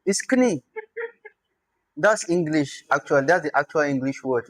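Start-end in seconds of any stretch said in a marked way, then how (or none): 0.3-0.6 "knie" with an audible K, higher and falling; K sounded. Shown as a demonstration. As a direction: say the K before the N in "knie". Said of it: "they deleted the K".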